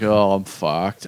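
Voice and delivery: low, slow groan of defeat; drawn-out low resignation; defeated old man. Acted. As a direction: groan it out, flat on the floor.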